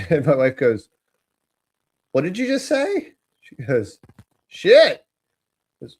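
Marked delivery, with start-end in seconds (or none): 2.1-3.1 high-pitched incredulous question; shocked parent. Retold or imitated. Wife hears daughter swear, high shocked question. 4.5-5.1 put-on high, loud little-kid exclamation; blurting child. Three-year-old outside a convenience store, high loud blurt.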